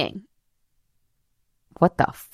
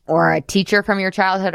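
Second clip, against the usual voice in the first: usual speaking voice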